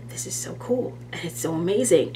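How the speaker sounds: soft, hushed gasp of wonder; falling in love with highlight videos, hushed awed whisper. Retold or imitated. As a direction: breathe it out in awe. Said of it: "I just was like".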